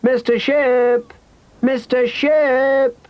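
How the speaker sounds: long drawn-out, high-pitched call; child hailing someone at a distance. A narrator's reading. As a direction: stretch the name to carry far. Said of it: "And Rosie called too".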